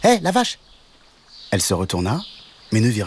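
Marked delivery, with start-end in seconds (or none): 0.0-0.6 much higher, tiny frog calling out; a small frog hailing the cow; high squeaky frog calling; little frog voice. A narrator's reading. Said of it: "une petite voix derrière elle".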